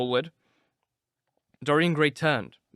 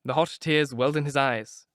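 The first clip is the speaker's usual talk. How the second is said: plain reading voice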